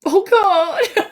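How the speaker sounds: high, crying through happy tears; overwhelmed happy sobbing; tearful high voice, laughing and crying at once. Real, not acted. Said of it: "They're happy tears".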